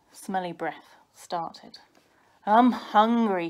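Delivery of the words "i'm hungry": moaning. A narrator's reading. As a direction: moan like a hungry little brother. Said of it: "he moaned".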